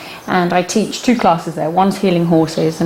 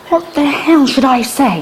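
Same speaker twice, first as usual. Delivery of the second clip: mock exasperation; 7 semitones higher than usual. Retelling flustered phone call.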